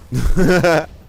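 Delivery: loud gloating laugh; mocking laugh. Real, not acted. Streamer laughing at the boss he is beating, loudly.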